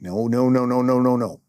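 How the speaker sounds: alarmed firm refusal; urgent no. Retold or imitated. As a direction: stop him with a firm repeated no. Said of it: "I was like".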